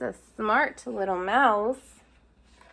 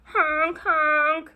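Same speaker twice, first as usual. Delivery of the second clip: loud, high goose honks; honking geese; 4 semitones higher, 6 dB louder than usual. Acted. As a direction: honk like geese flying overhead. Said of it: "they cry".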